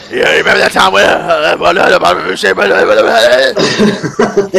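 Farmer Fred voice. Retold or imitated. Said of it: "And then he goes".

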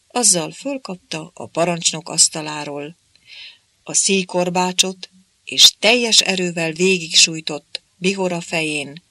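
ordinary delivery, nothing marked

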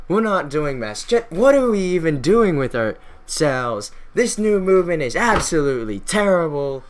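hammed-up outrage from a disgusted member; acted indignant rant. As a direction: berate the room, stretching the key words. Acted old-guard member's angry tirade.

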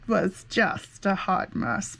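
usual speaking voice

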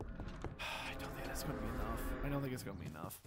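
soft, near-whispered gloom; dejected murmur. Real, not acted. Player doubting the dragon food, quiet and dejected. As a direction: sigh it under your breath, defeated. Doubts resources will suffice.

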